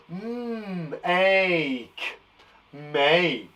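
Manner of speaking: "Mm, ache" stretched into separate sounds, then blended as "make"; sounding out. Shown as a demonstration. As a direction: hold "Mm", then "ache", then join them into "make". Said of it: "stamp on the sounds and listen".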